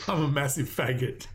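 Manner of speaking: can barely get the words out for laughing. Real, not acted. Telling a friend about the tweet, laughing. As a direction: read it through real laughter.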